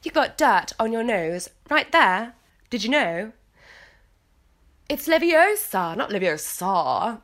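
put-on prim correcting English accent; Hermione Granger. Warming up a British accent by quoting film lines, put-on English. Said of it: "I have to channel Hermione Granger".

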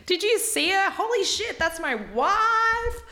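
high, loud, amazed gasp of a voice, slowing on wife; excited awe. Retold or imitated. Imitating the groom seeing his bride, high and amazed. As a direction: gush like he's just seen his bride. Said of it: "He's like".

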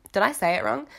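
ordinary delivery

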